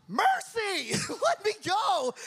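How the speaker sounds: high, loud cry for release; mock pleading shout. Man in a chokehold begging his attacker, hammed-up shout. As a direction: yell out your surrender, hammed up.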